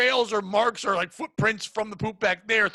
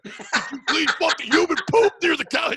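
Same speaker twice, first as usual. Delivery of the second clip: high, loud outburst told through laughter; comic blow-up, pitched up and loud; retold angry snap; 6 semitones higher, 6 dB louder than usual. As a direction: snap loudly in mock outrage. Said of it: "I just snapped".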